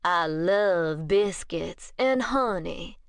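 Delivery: put-on slow, drawn-out, not-quite-Southern accent; molasses-slow Southern-ish accent. Vampire woman coos about biscuits in a slow, half-Southern drawl. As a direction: let every word ooze slowly, half Southern. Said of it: "molasses slow accent".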